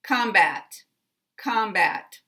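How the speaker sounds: "Combat" with COM strongest, bat unreduced, no schwa; primary then secondary stress. Shown as a demonstration. Teacher modelling two full vowels, "combat" stressed on COM. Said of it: "both of these contain a full vowel".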